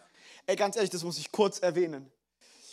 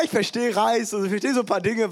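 ordinary delivery

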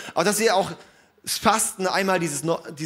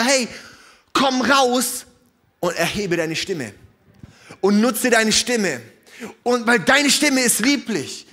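high, loud impassioned preaching over music; impassioned sermon voice; 5 semitones higher, 3 dB louder than usual. Acted. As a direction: rouse the congregation.

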